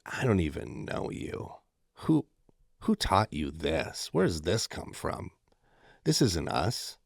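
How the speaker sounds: soft, slow, hushed disappointment; mock disappointed parent. Son quotes his parents' soft disappointed rebuke. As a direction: let it sink, like a let-down parent. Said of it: "they were like".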